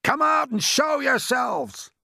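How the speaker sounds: high, loud, drawn-out command; shouted order. A narrator's reading. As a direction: holler it up to the top. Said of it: "shouted the chief of police".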